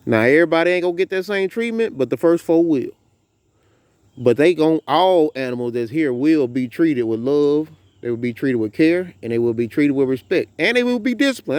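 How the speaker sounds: ordinary delivery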